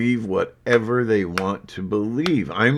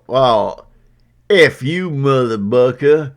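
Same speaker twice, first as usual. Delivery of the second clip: loud, higher imitation of an abuser; mock angry commenter; 4 semitones higher, 9 dB louder, slower than usual. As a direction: throw out a mock insult, loudly. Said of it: "if they go".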